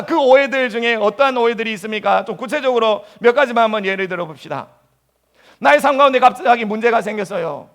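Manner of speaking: usual speaking voice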